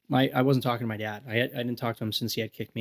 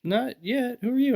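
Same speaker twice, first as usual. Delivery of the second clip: high, lightly voiced lady asking who he is; lightly voiced puzzled lady; 11 semitones higher than usual. Homeowner questions a stranger at her door, lightly voiced high. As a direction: play the confused homeowner a little higher. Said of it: "She's like".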